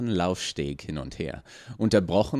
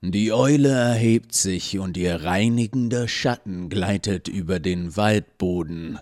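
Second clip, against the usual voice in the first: lightly voiced masked cultist, ominous; a hint of a throaty, solemn proclamation; deep guttural voice; 5 dB louder than usual. A narrator's reading. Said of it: "mit tiefer, kehliger Stimme". (leicht angedeutete tiefe, kehlige Stimme)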